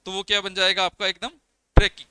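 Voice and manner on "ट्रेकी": emphatic answer. Real, not acted.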